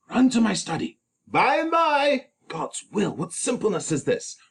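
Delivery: high, loud, urgent friar hurrying Romeo. Acted. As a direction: hurry him, someone's at the door. Friar's urgent lines, read high.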